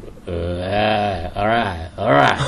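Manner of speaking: very slow, low catchphrase drawl; Matthew McConaughey impression. Host mimicking how the star likes to drop low, slow drawl. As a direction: drop it deep and stretch every word. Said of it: "เสียงเหมือนอะพี่".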